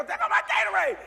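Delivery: very high, loud squeal; hammed-up shriek over a lost drink; alarmed cry. Man watching Gatorade fly in flipping car, alarmed squeal.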